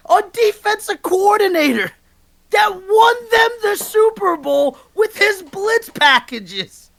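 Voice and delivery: high, slow, shouted outrage; real shouting sports rant at full tilt; peak rant. Real, not acted. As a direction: drag it out, yelling each word. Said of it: "all-time Maz rant right there".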